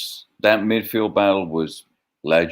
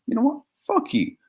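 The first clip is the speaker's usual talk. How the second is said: squeaky mocking taunt, higher; 4 dB quieter than usual. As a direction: pitch it up to mock him.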